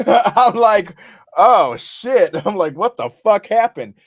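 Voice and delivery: high, loud, laughing disbelief; laughing shock. Retold or imitated. Covered in blood, laughing while telling his friend.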